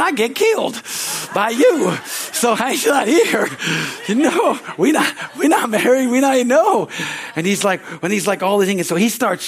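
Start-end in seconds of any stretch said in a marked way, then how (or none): 0.0-6.9 1 indignant protest. Retold or imitated. Protesting to girlfriend's dad.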